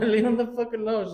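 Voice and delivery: high-pitched mock yell, lightly voiced; playfully shrieking at her to back off; mock yell at wife. Father mock-yelling at wife to leave son alone. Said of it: "I'm like".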